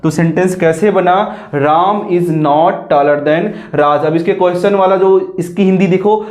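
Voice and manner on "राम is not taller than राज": slow dictation. Shown as a demonstration. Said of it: "teacher reads sentence slowly".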